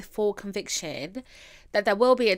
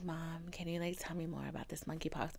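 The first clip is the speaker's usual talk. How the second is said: whisper; 5 semitones lower, 18 dB quieter than usual. Retold or imitated. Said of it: "I was like".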